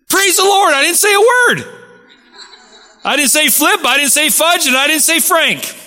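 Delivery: high-pitched, jubilant exclamation; re-enacted triumph. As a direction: crow over holding your tongue, well up in pitch. Re-enacts triumph after hurting his knuckles.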